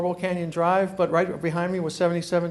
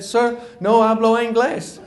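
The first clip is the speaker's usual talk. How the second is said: slow, higher, laughing; deadpan dodge in Spanish; imitating the roofer shrugging it off; roofer's reply; 4 semitones higher, 4 dB louder than usual.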